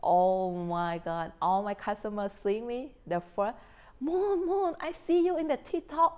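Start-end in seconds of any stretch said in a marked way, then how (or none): 4.0-6.1 high-pitched excited calling out; excited customer. Retold or imitated. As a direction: act out a customer thrilled to spot her. Voicing customers who spotted her.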